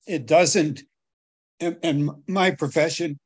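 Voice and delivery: ordinary talk, nothing marked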